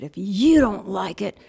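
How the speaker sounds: slow, emphatic mock yell; imitating an angry boss, measured and forceful; livid retold yell. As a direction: lay down an ultimatum, punching it slowly. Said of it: "he yelled at me".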